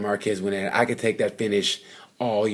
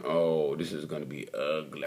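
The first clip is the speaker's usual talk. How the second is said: dreading; 7 semitones lower, 8 dB quieter than usual. Retold or imitated. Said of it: "And you're watching it going".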